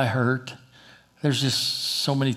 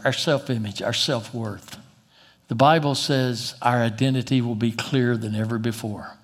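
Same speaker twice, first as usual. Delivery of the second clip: ordinary delivery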